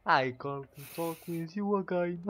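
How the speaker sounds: slow sung line in a fake English accent. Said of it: "Avec cet accent de contrefaçon".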